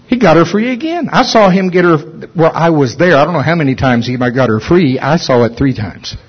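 ordinary talk, nothing marked

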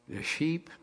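ordinary talk

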